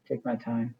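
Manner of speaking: ordinary delivery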